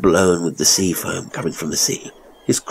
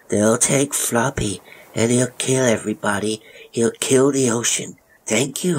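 put-on eerie childish parroting; disturbed little boy; 6 semitones higher than usual. A narrator's reading. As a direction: recite accusations like an odd small boy, pitch climbing. Narrator voices boy parroting adults.

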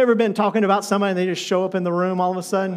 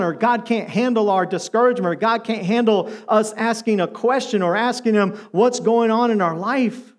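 normal talking voice

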